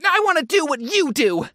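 high, eager fan declaration. Retold or imitated. Audience member to performers on stage, eagerly imitated.

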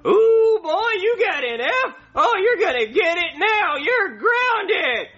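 high yelling dad voice; angry dad voice. Pretend. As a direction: scold him like a cartoon father. [name] voicing angry dad.

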